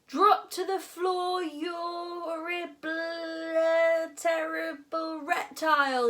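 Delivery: high, slow bellowed command; yelling. A narrator's reading. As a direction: roar it out, stretching every word. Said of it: "yelled Stoic".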